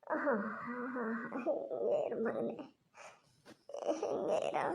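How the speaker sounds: soft, tearful hushing of a tired little one; tearful soothing. Real, not acted. Mother hushing her exhausted son, softly tearful. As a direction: comfort him through your own tears, quietly.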